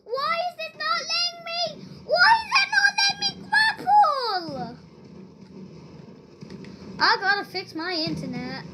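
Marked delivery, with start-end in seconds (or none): 0.0-4.8 high, whining frustration at the game; whiny high complaint. Real, not acted. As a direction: whine it up high, pleading with the game. Grappling hook won't work.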